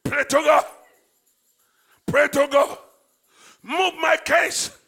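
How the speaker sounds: loud, driving prayer leader; preaching at full force. Acted. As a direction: urge the church to cry out to God. Leads congregation in loud prayer.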